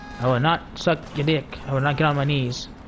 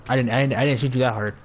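ordinary talk

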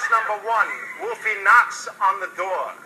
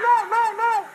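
squeaky pig; slower than usual. Acted. Pig refuses the wolf.

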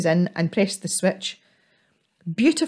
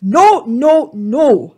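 loud, higher troll shout; 5 semitones higher, 10 dB louder, much slower than usual. Acted. Troll shouting to stop Ethel leaving, loud. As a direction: burst out to stop her leaving. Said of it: "Handley shouted".

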